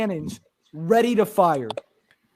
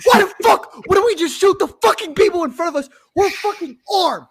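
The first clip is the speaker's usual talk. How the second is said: mock-furious pleading, pitched way up; exasperated rant; 11 semitones higher than usual. Retold arguing with fellow soldier.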